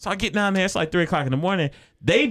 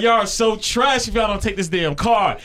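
shouting; 6 semitones higher than usual. Retold or imitated. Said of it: "yelling at the impound lot".

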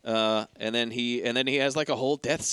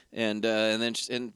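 ordinary talk

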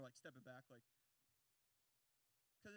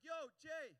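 high, loud hail; calling out; 6 semitones higher, 10 dB louder than usual. Retold or imitated. Preacher voicing God calling to him, loud hail. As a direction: call someone by name to get attention. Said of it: "God's like".

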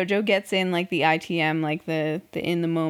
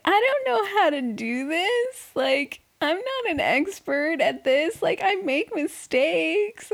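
mock crying; 9 semitones higher than usual. Imitating crying [name] in her interview, exaggerated mock crying. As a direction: imitate a tearful confession, pitched way up and stretched. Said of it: "she's crying".

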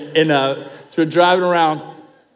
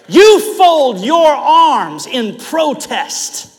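louder, higher, slowing preacher's build to a peak; preaching climax; 8 semitones higher, 4 dB louder, slower than usual. Acted. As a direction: drive the point home from the pulpit.

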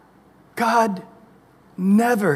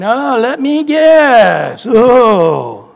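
high, loud, slow sarcasm; milk the mock guess; hammed-up teasing God; 5 semitones higher, 6 dB louder, much slower than usual. God mocking repeat sinner.

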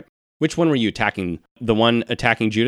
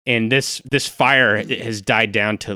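ordinary talk

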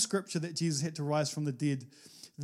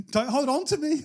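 laughing; 7 semitones higher, 4 dB louder than usual. Real, not acted. Laughing while quoting Jesus.

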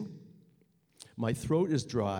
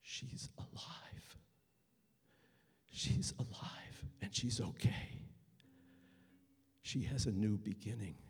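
awed whisper; 5 semitones lower, 9 dB quieter than usual. Retold or imitated. Leaving the rescued woman at her home, hushed whisper. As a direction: breathe it out in quiet disbelief. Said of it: "one thing going through my head".

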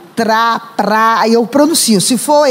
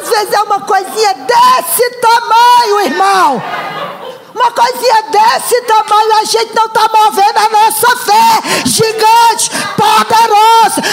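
full-force preaching; 8 semitones higher than usual. Acted. Preacher shouting to the congregation about small faith, preaching at full force.

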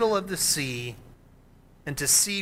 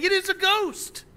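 high, mock-terrified cry of "It is a ghost"; frightened cry; 13 semitones higher than usual. Disciples cry out at the figure on the sea, higher and afraid. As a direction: cry it out like scared fishermen. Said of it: "they cried out".

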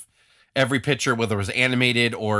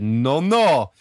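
exaggerated accent as the United States, louder; put-on American accent; 3 semitones higher, 5 dB louder than usual. Podcaster voicing the United States refusing Iraq, in a put-on accent. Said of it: "I gave an accent to the United States".

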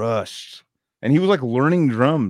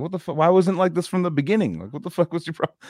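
high, teasing mock rant; mock cussing out; 8 semitones higher, faster than usual. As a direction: chew him out jokingly. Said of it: "cussing him out".

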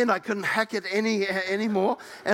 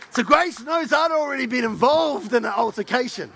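normal talking voice

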